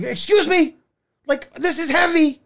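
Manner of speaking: high-pitched, insistent retold demand; acted-out strained complaint, voice way up; exasperated. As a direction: push to get past with a heavy load. Demanding passage with heavy computer.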